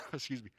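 sudden choking coughs; coughing fit. Real, not acted. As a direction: cough hard mid-sentence. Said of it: "I'm choking even saying it".

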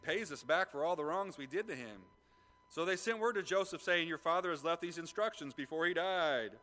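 high, loud preaching read; fervent Bible reading; scripture reading. Acted. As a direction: proclaim the passage from the pulpit. Reads scripture at full force.

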